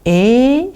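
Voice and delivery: E drawn out on a rising tone; long E. Shown as a demonstration. Teacher shows how it is really pronounced, long E. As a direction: hold E long and let it climb. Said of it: "Donc nous prononçons bien".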